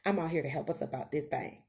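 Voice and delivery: normal talking voice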